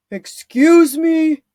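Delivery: loud, slow disbelieving question; a guard who can't believe it; incredulous guard. A narrator's reading.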